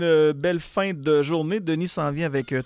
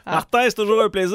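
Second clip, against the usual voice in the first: loud laughing; 8 semitones higher, 9 dB louder than usual. Real, not acted. Animateur rit fort au micro.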